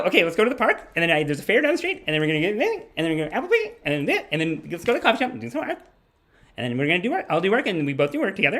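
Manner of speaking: put-on high, fast, bubbly girlfriend voice; imitating happy girlfriend. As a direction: rattle off plans like an excited girlfriend. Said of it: "she was like".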